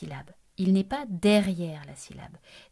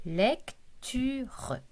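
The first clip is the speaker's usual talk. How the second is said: "Lecture" in three separate beats, lec, tu, re; split into syllables; 3 semitones higher, 3 dB quieter than usual. Shown as a demonstration. Teacher cutting the word for a pupil, "Lecture" syllable by syllable. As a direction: break "Lecture" apart with a short pause after each syllable. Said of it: "Coupons ce mot en syllabes".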